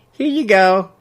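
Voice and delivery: lightly voiced elderly woman; prim old busybody; old gossip lady voice. Mabel voiced.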